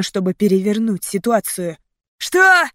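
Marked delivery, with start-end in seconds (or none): 2.2-2.7 loud, high, angry bark; barked question. A narrator's reading. Monster reacting to the warning, angry bark. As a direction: bark it in disbelief. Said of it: "рявкнул монстр зубочисткой".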